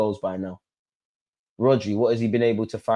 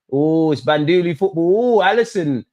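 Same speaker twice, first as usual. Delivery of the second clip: excited outburst; 5 semitones higher, 5 dB louder than usual. Real, not acted. Fan reacting to chaotic play.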